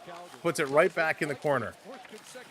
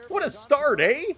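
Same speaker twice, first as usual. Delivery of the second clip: high-pitched, playful Canadian "eh"; mock Canadian; 9 semitones higher, 4 dB louder than usual. Shown as a demonstration. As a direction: ham up the Canadian accent, pitched way up. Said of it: "That was so Canadian of me".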